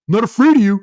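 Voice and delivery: high, loud tough-guy bluster; defiant yell. Retold or imitated. Drunk kid squaring up to Nash, high defiant shout. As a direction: puff up and shout it at a giant.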